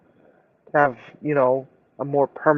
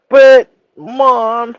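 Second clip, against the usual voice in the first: put-on high, loud whiny child; kid voice; 8 semitones higher, 7 dB louder, much slower than usual. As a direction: whine like a kid. Voicing son whining to mom.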